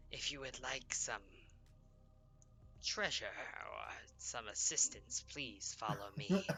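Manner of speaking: slow with an eerie pause before "treasure"; creepy pause. Acted. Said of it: "I like the super creepy pause".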